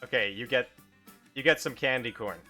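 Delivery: ordinary delivery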